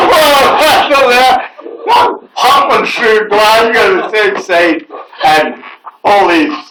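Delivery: high and loud, slow, through laughter; laughing through words. Real, not acted. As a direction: talk while still cracking up. Laughing while telling the story.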